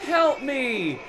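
very high strained cry for help; attempted scream. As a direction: squeal it out, hammed-up and desperate. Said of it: "I tried to scream".

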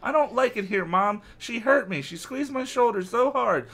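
put-on high complaining kid voice; lightly voiced upset kid; whiny boy. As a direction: whine it to mom. Evan complaining to mother.

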